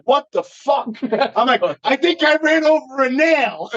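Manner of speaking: high, hammed-up shock; fake surprise. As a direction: play dumb, pitch way up. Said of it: "I had to put on an act".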